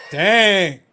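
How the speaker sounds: loud drawn-out groan of dismay; mock outrage. Real, not acted. Reacting to a chat burn with a loud mock groan. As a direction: cry out at the insult.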